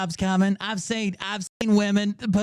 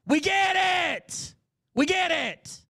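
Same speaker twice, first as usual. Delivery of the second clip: exasperated shout; 12 semitones higher than usual. Real, not acted.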